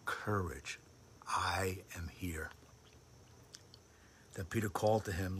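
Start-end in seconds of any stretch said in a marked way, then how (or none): 0.1-2.5 slow soft whisper; whispered comfort. Acted. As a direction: whisper it like a promise.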